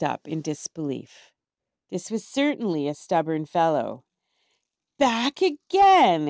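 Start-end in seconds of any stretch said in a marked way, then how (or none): 5.0-6.3 slow, loud, high dragon snort. A narrator's reading. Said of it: "it snorted".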